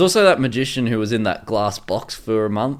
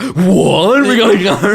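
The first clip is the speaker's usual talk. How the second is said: much higher, louder mock Reed; mimicking Reed; 8 semitones higher, 7 dB louder, slower than usual. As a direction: ham up a distracted scientist. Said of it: "He's like".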